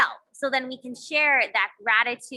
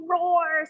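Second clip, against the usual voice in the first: playful high lion roar; mock roar, pitched up and drawn out; 6 semitones higher, 3 dB louder than usual. Shown as a demonstration. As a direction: roar it out like a lion's breath. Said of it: "Breathe out like a lion".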